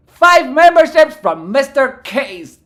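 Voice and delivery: loud, high and slow thank-you; big excited donation callout; hyped shout-out. Real, not acted. Thanking a chat member, hyped.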